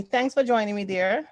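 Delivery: ordinary delivery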